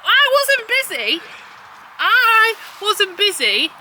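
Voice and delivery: high, loud, slow indignant protest; mock indignant. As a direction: drag it out in mock outrage. Mock-indignant retort to husband.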